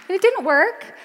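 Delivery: usual speaking voice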